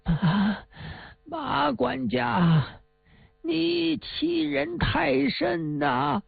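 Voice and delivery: slow, gasping words, mildly breathless; a hint of a trembling old woman fighting for breath. Old mother to the debt collector, slow and short of breath. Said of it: "上气不接下气地说呀".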